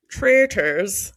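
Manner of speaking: put-on Traitors in a lightly done Irish accent, like Maura. Podcast host tries Maura's Irish pronunciation of Traitors, light Irish accent. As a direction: copy Maura's Irish way of saying Traitors, half laughing. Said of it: "Like Maura says it".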